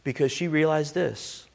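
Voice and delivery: ordinary talk, nothing marked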